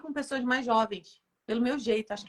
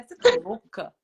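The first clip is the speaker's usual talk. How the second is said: raised, louder incredulous question; higher, louder retold reproach; mock self-scolding; 6 semitones higher, 8 dB louder than usual. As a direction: scold yourself in disbelief, half joking. Scolding herself in retold thought.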